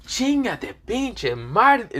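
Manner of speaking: slow, high, drawn-out Spanish swearing; mock Spanish cursing. Issei answering where he's from, slow high Spanish curse. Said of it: "Issei cuts in Spanish".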